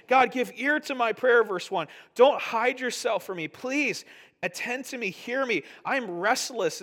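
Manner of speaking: a high, loud, urgent plea to God; pleading prayer. Retold or imitated. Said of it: "He's like".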